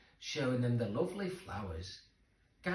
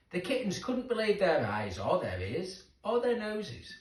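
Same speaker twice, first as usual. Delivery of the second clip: plain reading voice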